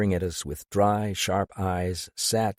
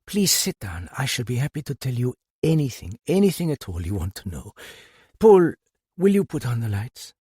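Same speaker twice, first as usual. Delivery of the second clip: lightly voiced older woman; 4 semitones higher than usual. A narrator's reading. Madame invites them, calls Paul.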